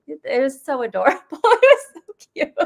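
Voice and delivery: high, laughing coo of delight; retold gushing. Telling friends how cute their accents were, gushing. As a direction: gush high and quick, breaking into laughter.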